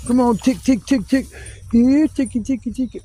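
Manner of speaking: high coaxing "here, ticky" voice; mock pet-calling. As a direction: call the ticks like you'd call a kitten.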